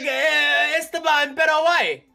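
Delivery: teasing impression with a Spanish accent; mock Spanish accent. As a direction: imitate someone in a hammed-up Spanish accent. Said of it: "why you gave him that accent".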